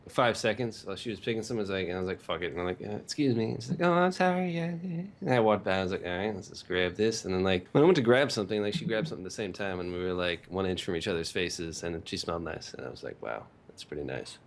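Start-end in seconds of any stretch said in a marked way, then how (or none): none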